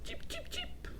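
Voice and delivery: very high, soft cheep, cheep; a little girl's sing-song chick sound. Acted. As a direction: chirp like a baby bird. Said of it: "sang Jasmine".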